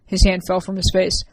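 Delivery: usual speaking voice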